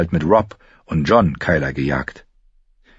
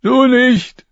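high, louder, spat-out jab; spiteful jab; 6 dB louder than usual. A narrator's reading. Lommi spits a jab at Arya, spiteful. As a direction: sneer it at the girl.

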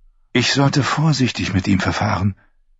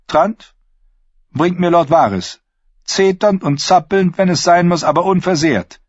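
commanding queen; 10 semitones higher than usual. Acted. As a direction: bark the order like a furious queen. Queen orders guard to fetch Varys.

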